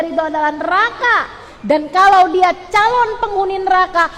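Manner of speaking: high-pitched, hammering preacher's warning; preaching at full force. Real, not acted. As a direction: drive each consequence home, building relentlessly.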